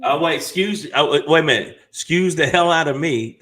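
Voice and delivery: loud, sassy "Excuse the hell out of me". Shown as a demonstration. Showing the host the proper way, loud and sassy. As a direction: demo the attitude with full sass. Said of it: "That's how you have to say it".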